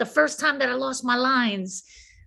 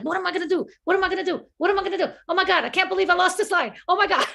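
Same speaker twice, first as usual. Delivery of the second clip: higher, rushed mock panic; panicking; 7 semitones higher, much faster than usual. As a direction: replay the frantic moment of a lost line. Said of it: "I'm panicking".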